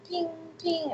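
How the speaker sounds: high, soft sung ping ping; mock phone ping. Imitating her phone pinging at two in the morning. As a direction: chime it like a phone alert.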